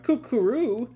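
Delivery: high-pitched bird coo; singsong pigeon call, voice way up. Retold or imitated. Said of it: "Happy bouncing around".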